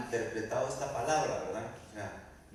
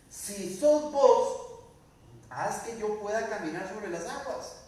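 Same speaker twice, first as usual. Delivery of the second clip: slow, loud, high "si sos vos"; challenging demand; 5 semitones higher, 4 dB louder, slower than usual. Acted. Pedro daring Jesus to prove himself, loud challenge. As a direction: test him with a bold condition. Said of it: "Porque pusiste una señal".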